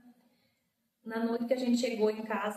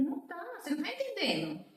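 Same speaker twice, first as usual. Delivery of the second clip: high, quick retold protest; insistent denial; 9 semitones higher, faster than usual. Sister telling Zé she is not going, high urgent protest. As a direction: push back urgently without shouting.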